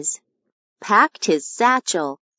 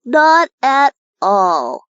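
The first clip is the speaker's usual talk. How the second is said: slow, sulky little humph; 5 semitones higher, 3 dB louder than usual. Acted. Ollie answers his own question with a slow sulky humph. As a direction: huff it out, dismissive. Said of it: "he humphed".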